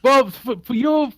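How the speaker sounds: ordinary delivery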